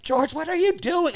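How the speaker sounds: very high-pitched mock scream; shrieky retold scolding, not full volume; screaming grandmother. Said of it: "Like, screaming at him".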